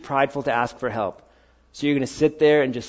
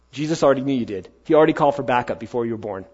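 ordinary delivery

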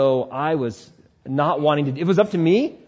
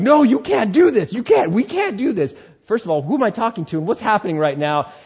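body panicking; 7 semitones higher, 4 dB louder than usual. Retold or imitated. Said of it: "My body was physically going".

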